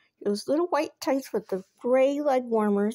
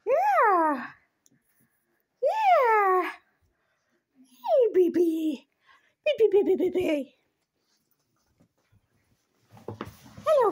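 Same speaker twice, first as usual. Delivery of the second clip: put-on high, cooing baby talk to the cat; pet talk; 8 semitones higher, 4 dB louder than usual. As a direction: coo at the kitty like a baby. Cooing to her cats.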